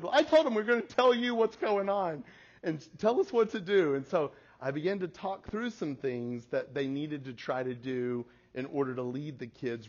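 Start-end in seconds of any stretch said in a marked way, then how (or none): 0.0-3.9 high, loud imitation of daughter; hammed-up urgent teenage plea; worked-up pleading. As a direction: plead insistently, voice pitched up.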